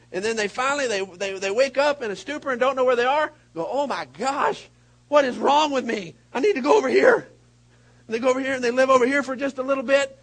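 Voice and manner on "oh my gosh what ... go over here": mock panic, raised and loud; loud, dramatic dismay rising in pitch; alarmed realization. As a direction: wake up shocked at yourself. Acts out folks waking up shocked.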